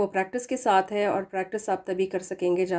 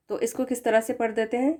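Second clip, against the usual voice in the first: normal talking voice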